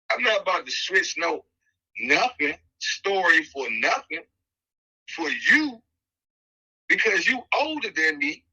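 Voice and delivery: high-pitched, heated comeback to a cellmate; heated retort. Retold or imitated. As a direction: snap back, fed up and raising your pitch.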